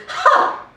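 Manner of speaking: sharp, high burst of derision; a single contemptuous laugh; scornful "Ha!". Acted. Wife dismissing Mussolini's famous mistress, scornful "Ha!".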